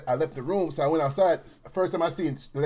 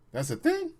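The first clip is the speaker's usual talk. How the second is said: surprised; 12 semitones higher, 4 dB quieter than usual. Retold or imitated. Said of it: "I'm like".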